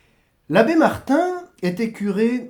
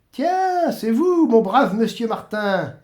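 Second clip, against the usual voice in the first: voix plus aiguë, accueil enjoué; le saint qui reconnaît un vieil ami; Saint-Pierre jovial; 5 semitones higher, 4 dB louder, slower than usual. Acted. (Saint-Pierre jovial et aigu)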